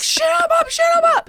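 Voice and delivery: high, loud gleeful shout; mock cheering shout. Cohost acts out her glee at the movie shootout, high playful shout. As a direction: cheer on the gunfight like a kid. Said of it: "I'm like".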